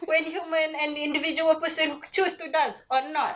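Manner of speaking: Kelantanese English read high and laughing; Kelantanese accent. Pretend. Reading text as a Kelantanese person speaking English, high and laughing. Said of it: "a Kelantanese person speaking English".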